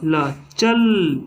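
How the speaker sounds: चल drawn out very slowly, sound by sound; teacher spelling out चल syllable by syllable; slow syllable demo. Shown as a demonstration. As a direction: say ल, then चल, pulling each sound apart. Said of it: "तो बोलते हैं च".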